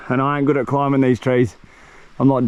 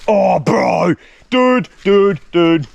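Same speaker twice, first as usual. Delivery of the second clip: excited shouting; 3 semitones higher, much slower than usual. Real, not acted. Spotting a coconut on the island, excited shouting. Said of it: "I get really bloody excited".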